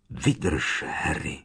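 soft, low, as if from far away; a faint ghostly echo; distant echo. A narrator's reading. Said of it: "Jeho hlas zněl jako ozvěnou z dálky". (jako ozvěna z dálky)

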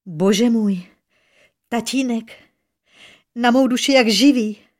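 gasped-out cry of recognition, only moderately loud. A narrator's reading. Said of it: "vykřikla podruhé". (překvapené zvolání)

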